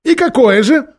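high, loud demanding question; impatient demand. A narrator's reading. King demanding his lords' verdict, loud impatient question. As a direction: press for the answer. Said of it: "спросил король".

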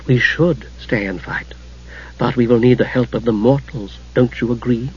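sweet, soft near-whisper, slowed; disarming sweetness. A narrator's reading. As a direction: coax him with honeyed whispered words. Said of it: "with sudden, disarming sweetness".

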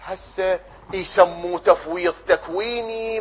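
normal talking voice

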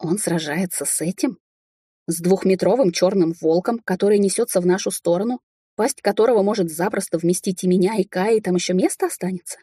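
ordinary delivery, nothing marked

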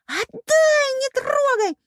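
very high squealed demand; shrieking. A narrator's reading. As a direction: squeal frantically to get it back. Squealed struggle over the medallions. (истошный визг)

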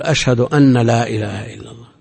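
أن said as أنّ with a held, doubled nun. Retold or imitated. Scholar imitates muezzins who wrongly double the nun. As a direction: lean on the nun of أن, أنّّ. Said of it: "يشدد النون".

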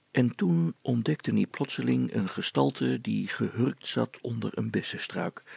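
ordinary delivery

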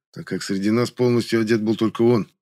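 plain reading voice

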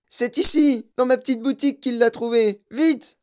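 high, loud excited shouting; overexcited shopkeeper, yelling high; frenzied yelling. A narrator's reading. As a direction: holler the news to all. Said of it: "hurla-t-il".

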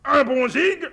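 delighted surprise. Acted. General amused by her slang.

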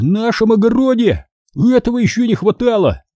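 high-pitched exclamation of dismay; dismayed gasp. Acted. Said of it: "охнул лось". (ахнуть с досадой, высоко)

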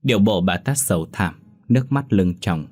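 usual speaking voice

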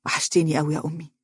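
hushed, quick, understated emotion; a touch of tears held back; tearful longing. A narrator's reading. Son embracing his mother after missing her, quietly moved. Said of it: "وهو يقول بعينين دامعتين".

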